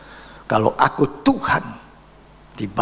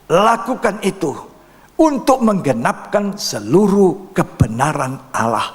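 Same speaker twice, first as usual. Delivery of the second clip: quoting Jesus; 7 semitones higher than usual. Retold or imitated. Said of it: "Apa kata Yesus?".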